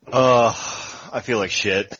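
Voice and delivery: groggy morning-after voice; hungover. Real, not acted. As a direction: sound rough after a night drinking. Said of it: "one too many drinks last night".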